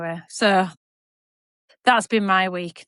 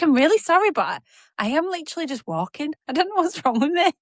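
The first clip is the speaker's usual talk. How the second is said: retold breathless apology; 7 semitones higher, faster than usual. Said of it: "I couldn't even breathe".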